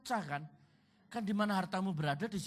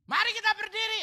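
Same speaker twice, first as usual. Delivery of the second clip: loud, high-pitched call to stand; hammed-up loud worship-leader call; mock song leader yell; 10 dB louder than usual. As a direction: shout it over the crowd like the song leader. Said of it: "Song leader teriak".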